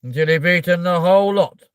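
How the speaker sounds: loud, high, commanding tirade from a film villain; Matilda's headmistress. Acted. Ordering a child to finish the chocolate cake, imperious. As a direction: boom it out like a tyrant giving orders. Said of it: "I'll be like".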